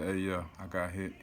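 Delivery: soft, unbothered report; calm. Retold or imitated. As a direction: keep it chill despite the wound. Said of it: "I was so calm".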